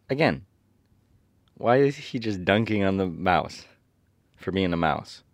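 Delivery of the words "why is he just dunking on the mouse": usual speaking voice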